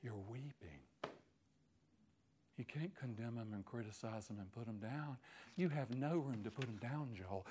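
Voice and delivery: soft, low earnest plea; hushed. Real, not acted. Preacher pleading softly with the congregation. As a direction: say it quietly, close to tears.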